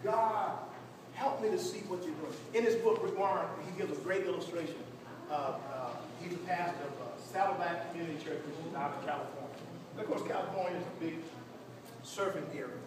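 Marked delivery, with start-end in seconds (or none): none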